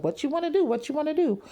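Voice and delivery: a high taunting dare to fight. Retold or imitated. Vlogger imitating Tamar daring Tony to fight, high mock taunt. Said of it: "She's like".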